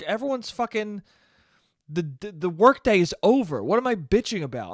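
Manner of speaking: ordinary delivery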